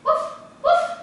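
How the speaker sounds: high yappy woof woof; dog barking. Shown as a demonstration. Teacher showing the class what a dog says, high barking. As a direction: bark like a little dog for the kids. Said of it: "What does a dog say?".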